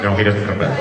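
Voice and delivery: low voice playing the unbudging writer; deadpan bearer of news. Writer's reply in retold dialogue.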